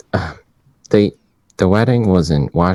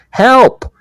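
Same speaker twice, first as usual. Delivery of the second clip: high, loud mock cry for help; shout for help; 5 dB louder than usual. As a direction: shout out the window to a jogger, pretend. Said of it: "I'm gonna shout".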